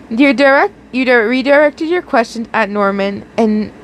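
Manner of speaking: ordinary delivery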